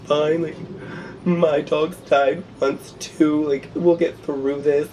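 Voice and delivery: high, drawn-out tearful imitation; mock crying. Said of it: "hysterically crying with her".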